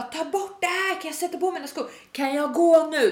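high-pitched exasperated demands; mock screaming. Customer wanting polish off, mock screaming. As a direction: imitate a customer yelling to be let go. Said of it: "hon bara står och skriker bredvid mig".

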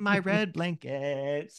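put-on slow, doting little girl voice; hugging her new blanket, drawn out; giddy child. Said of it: "And she's like".

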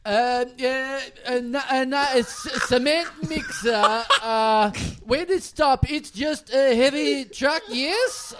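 put-on halting, slow, slightly high foreign accent; Borat. Guest fumbling the booked impression, Borat. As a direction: stumble through a half-hearted mock accent. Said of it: "He wants an impression of Borat".

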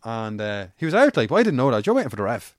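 ordinary talk, nothing marked

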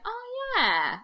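high, drawn-out "oh, yeah"; dawning realisation. Retold or imitated. Retelling how she finally heard the French Canadian difference, dawning realisation.

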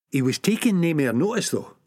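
plain reading voice

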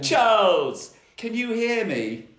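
high, loud call; deafened calling. Acted. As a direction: shout as if your ears are ringing. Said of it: "no need to shout".

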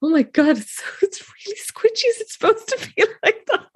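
high giggly whisper; laughing whisper. Real, not acted. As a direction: whisper it, barely holding back laughter. Retold amazed whisper, laughing.

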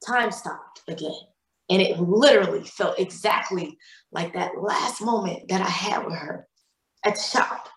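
ordinary talk

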